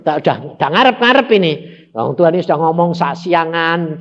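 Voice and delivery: ordinary talk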